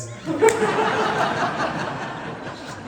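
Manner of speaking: loud high-pitched burst of laughter. Real, not acted. Monk bursts out laughing at the guru's joke, loud and high.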